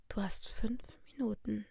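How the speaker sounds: soft, whispery ultimatum; quiet threat. A narrator's reading. Captor giving Isabel a deadline, softly menacing. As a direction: breathe the threat into the phone.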